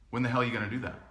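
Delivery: quiet, dead-serious challenge; level, unsmiling dare; deadpan challenge. Retold or imitated. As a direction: put it to him softly, without a smile. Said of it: "I looked at him just like that. No smile".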